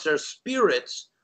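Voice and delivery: ordinary delivery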